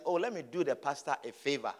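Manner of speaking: mock condescending favor. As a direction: pretend you are graciously doing someone a favor. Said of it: "it looks as if".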